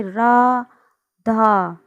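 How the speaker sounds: very slow Radha with the vowel held long; stretched call. Shown as a demonstration. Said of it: "उसी वर्ण के पीछे लगेगा जो हमारा खिंच के बोलेगा". (राधा को बहुत खींचकर धीमे बोलना)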